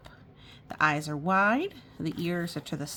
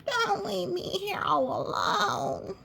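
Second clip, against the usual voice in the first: high slow pleading voice; high slow plea; pleading sheep; 13 semitones higher, slower than usual. Acted. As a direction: beg not to be left behind. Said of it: "cried Sheep".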